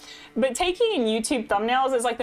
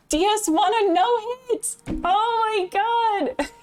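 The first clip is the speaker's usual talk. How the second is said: high, drawn-out squeal of amazement; gasp, then stretch it out high and thrilled; amazed excitement; 12 semitones higher, slower than usual. Real, not acted. Streamer reacting to Layla's no-hit, amazed squeal. Hears of viewer's big achievement.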